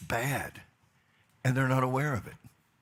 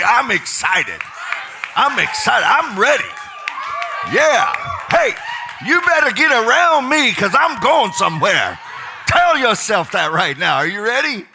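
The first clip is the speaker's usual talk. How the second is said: much higher, much louder hype; excited shout; 8 semitones higher, 11 dB louder than usual. Real, not acted. Leader firing up the crowd, a loud excited shout. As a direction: pump the room up, shouting. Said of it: "stand up and stretch and say".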